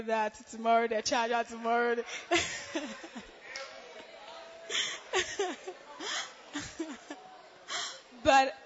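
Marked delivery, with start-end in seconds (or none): 2.1-7.4 half laugh, half sob, higher; laughing crying. Real, not acted. To the congregation, laughing tearfully about her broken phone. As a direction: giggle that tips into tears.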